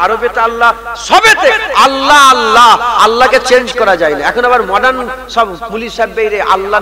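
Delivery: high, loud preaching that keeps building; rising loud chanted praise of Allah; fiery sermon. Acted. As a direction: preach it to the whole crowd at full force.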